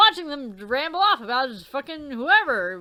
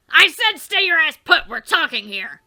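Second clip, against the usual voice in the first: louder, higher furious order to stay; angry shout; 4 semitones higher, 7 dB louder than usual. Acted. Character yells at Dave not to leave, angry. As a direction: yell him back into place. Character yelling at someone leaving.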